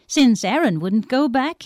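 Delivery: ordinary delivery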